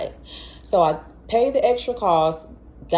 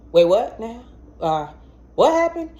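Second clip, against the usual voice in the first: stunned disbelief; 5 semitones higher than usual. Retold or imitated. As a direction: jump up in disbelief. Shocked by brain scan news.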